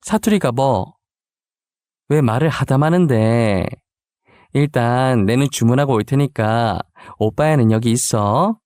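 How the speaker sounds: casual Busan satoori intonation; Busan dialect. Acted. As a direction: answer in an easy, natural Busan accent. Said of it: "너무 자연스럽게 사투리를 구사해서".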